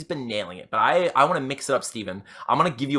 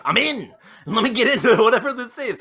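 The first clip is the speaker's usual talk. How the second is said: eager dad voice; 9 semitones higher, 5 dB louder than usual. Retold or imitated. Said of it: "Steven's dad's gonna be like".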